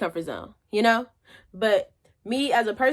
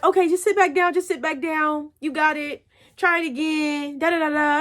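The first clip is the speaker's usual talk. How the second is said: self-coaching; 5 semitones higher than usual. Retold or imitated. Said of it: "And I was like".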